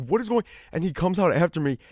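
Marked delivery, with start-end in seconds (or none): 0.1-0.4 dad's startled high-pitched call; mock-alarmed dad, much higher. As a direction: imitate a dad shouting in alarm. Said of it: "My dad's like".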